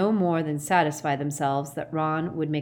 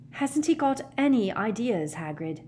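put-on high, urgent girl's plea; desperate; 6 semitones higher than usual. A narrator's reading. Hermione pressing Hagrid for answers, high and desperate. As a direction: plead high, dropping on the name. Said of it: "asked Hermione desperately".

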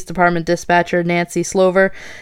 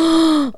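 gasp; 9 semitones higher than usual. Real, not acted. Reacting to grim quote.

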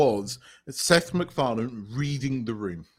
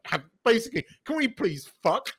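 lightly acted polite request, pitched up; Isaac's proposition; 7 semitones higher, 3 dB louder than usual. Retelling Isaac propositioning Grayson, mock-polite request. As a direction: put on a higher voice for an earnest, polite ask.

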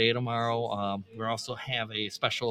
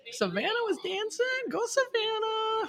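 excited cheer. Real, not acted. Cheering a dancer.

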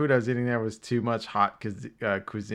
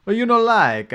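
high, loud mock Italian accent; exaggerated Italian host voice; mock Italian waiter; 11 semitones higher, 10 dB louder than usual. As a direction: play the pushy Italian waiter, hammed up. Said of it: "they'd always come to like".

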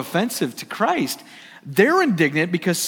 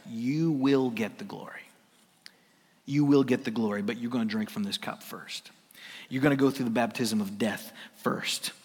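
hushed voice of Jesus; 7 semitones lower, 7 dB quieter than usual. Acted. Jesus voiced intimately to disciples.

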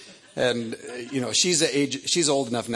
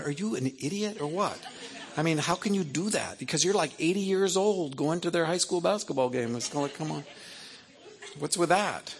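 higher-pitched, mildly exasperated disbelief; mock incredulous; 6 semitones higher than usual. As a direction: challenge them with playful incredulity. Acted rebuke of older fathers.

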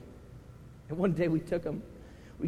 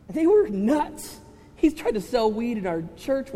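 high, loud, slow "nuts"; excited exclaim; 7 semitones higher, 9 dB louder than usual. Real, not acted. Pastor telling the church how wild the kids were, loud and high. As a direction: blurt it with amused disbelief.

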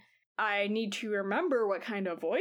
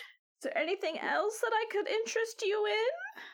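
put-on old lady voice; 10 semitones higher than usual. Granny Foam offers goods.

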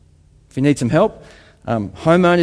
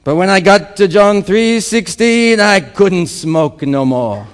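put-on loud African accent, slowing at the end; 4 semitones higher, 5 dB louder, slower than usual. Convert telling how he stopped smoking, African-accented oratory. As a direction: start high in an African accent, settle slowly. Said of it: "in obviously African accent".

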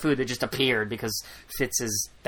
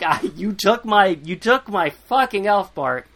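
angry complaint; 6 semitones higher, 5 dB louder than usual. Retold or imitated. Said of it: "really pissed".

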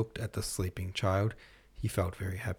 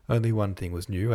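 plain reading voice

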